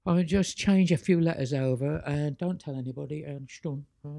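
a hammed-up English Monty Python accent, a touch fast. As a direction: play the angel as a cheeky Monty Python Englishman, nudge-nudge style. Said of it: "I love to put on this English Monty Python accent".

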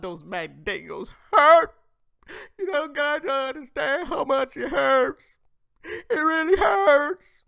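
loud, high-pitched fake wail; mock crying. Host mocking woman who says it hurts, mock wail. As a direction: wail it slowly, hammed-up and very high.

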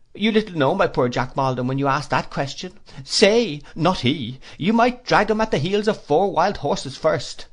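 put-on high, fluttery elderly mother; fussy old lady. A narrator's reading. Old lady voiced in story.

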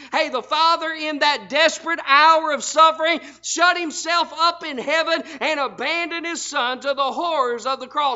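ordinary talk

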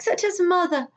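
lively storybook reading; reading to children. A narrator's reading. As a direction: read it aloud to little ones, full of wonder. Reads a picture book to children.

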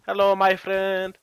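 slow Mexican accent greeting; lightly voiced Mexican accent. Shown as a demonstration. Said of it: "I can do, like, a".

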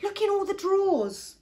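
high, loud acted order; urgent calling out. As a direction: call out the search urgently. Gran urges search over crying.

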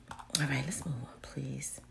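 soft unvoiced whisper; whispered plea. Real, not acted. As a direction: whisper it like you're done. Whispered, tired of browsing.

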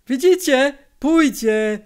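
high, pleased exclaim; joyful. A narrator's reading. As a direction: point it out with glee. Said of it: "zauważył z radością".

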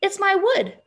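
put-on high, surprised girl's retort; lightly indignant surprise, pitched high. A narrator's reading. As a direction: answer as if taken aback. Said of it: "surprised by the question".

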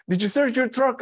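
high-pitched imitated sister; questioning sister. Sister asking brother about his truck, insistent. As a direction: ask it high and urgent, as the sister.

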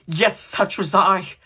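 loud, high, slow self-reproach; drawn-out ghostly wail of remorse; anguished cry. A narrator's reading. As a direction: cry out in bitter confession. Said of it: "cried the phantom".

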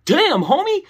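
high, rising reaction of dismay; mildly taken aback. Retold or imitated. Hearing the crowd may throw turds, taken aback. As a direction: let the pitch climb in mock shock.

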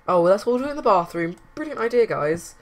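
high, loud mock praise; sarcastic. Real, not acted. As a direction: praise the dumb idea with scorn. Sims crowd into the bathroom.

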